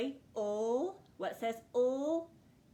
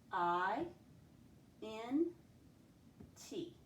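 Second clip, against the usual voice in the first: normal talking voice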